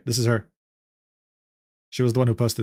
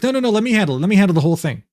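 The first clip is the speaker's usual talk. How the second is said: mock insistence, pitched up; insincere offer; 5 semitones higher, 3 dB louder, faster than usual. Friend offers to cover the bill, mock insistent. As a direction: put on a show of insisting. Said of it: "he's actually just saying this and doesn't actually mean it".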